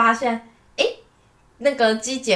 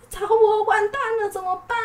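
panic; 13 semitones higher than usual. Retold or imitated. Realising she forgot the file.

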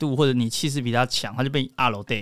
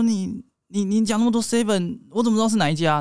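higher mock-angry boyfriend voice; 6 semitones higher than usual. Boyfriend complaining about too many 7-Elevens, mock angry. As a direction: play the boyfriend grumbling, raised pitch. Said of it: "就男生生气啊".

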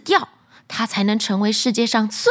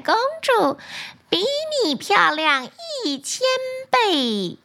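shimmering echoing mirror voice; 6 semitones higher than usual. Acted. Magic mirror answers again.

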